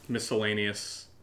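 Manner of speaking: ordinary talk, nothing marked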